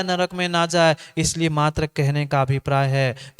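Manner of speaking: ordinary delivery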